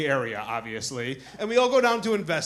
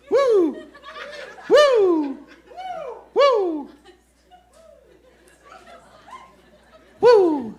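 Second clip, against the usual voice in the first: high, loud "woo" whoop; short, falling cheer; 6 dB louder, much slower than usual. Real, not acted. Whooping along with the crowd, high and loud.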